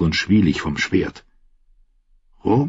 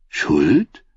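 puzzled; 2 semitones lower than usual. A narrator's reading. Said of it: "Osney klang verblüfft".

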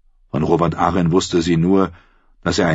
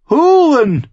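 much higher, louder scoffing question; outraged echo; 8 dB louder than usual. A narrator's reading. Lysa scoffs at Sansa's word, high and loud. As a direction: throw the word back in disbelief.

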